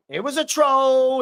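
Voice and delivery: high, drawn-out TROLL; triumphant troll-spotting call; gleeful crowing. Real, not acted. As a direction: crow it slowly, holding TROLL up high. Celebrating a troll caught on stream.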